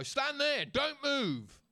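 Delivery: high, barked order from a guard; security guard order. Retold or imitated. Airport security ordering the traveller to freeze, mock stern bark. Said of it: "and they go".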